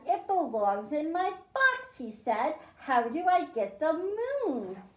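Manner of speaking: ordinary talk, nothing marked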